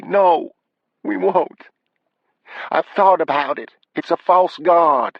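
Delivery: high, emphatic upset wife; high-pitched wife, upset and insistent; distressed wife. Acted. Wife contradicts husband in distress.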